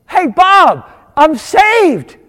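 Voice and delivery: loud, high-pitched call across a room; excited shout. Retold or imitated. Spotting Bob across the church foyer, excited shout. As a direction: shout the news out to an old friend.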